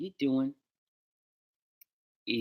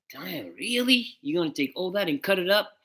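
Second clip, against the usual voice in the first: high-pitched mock disbelief, stretched then quicker; replayed astonished protest, a bit louder; incredulous reaction; 8 semitones higher, 5 dB louder than usual. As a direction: act out being taken aback, pitch jumping up. Acting out own reaction to edits.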